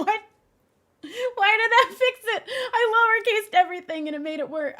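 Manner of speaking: real laughing incredulity; laughing disbelief. Real, not acted.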